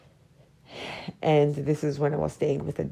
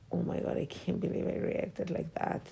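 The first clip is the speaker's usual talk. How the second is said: whispered inner thought; 8 dB quieter than usual. Retold or imitated. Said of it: "I was like".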